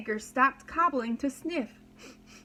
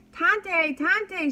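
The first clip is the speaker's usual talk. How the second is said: high, loud call into the forest; 7 semitones higher, 6 dB louder than usual. Acted. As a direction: call for the old woman across the trees. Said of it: "she called over and over".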